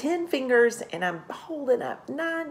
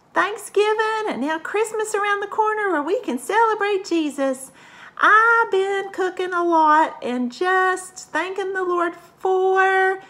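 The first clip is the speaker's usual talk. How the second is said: much higher, louder country cook voice; a cheery farm cook greeting the kids; slow, high drawling country lady; Missus Brown puppet; 11 semitones higher, 5 dB louder than usual. Pretend. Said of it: "Hello, Missus Brown".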